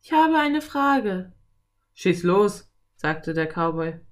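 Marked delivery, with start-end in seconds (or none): 0.0-1.3 put-on high, slow, louder girl's voice; drawn-out high call. A narrator's reading. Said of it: "rief sie". 1.9-2.7 a hint of cowboy, louder; cowboy's brisk go-ahead, lightly played; lightly voiced cowboy. A narrator's reading.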